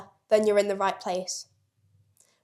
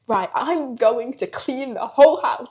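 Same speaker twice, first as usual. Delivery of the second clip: mock determination; 3 semitones higher, 6 dB louder than usual. Acting sudden hypo motivation.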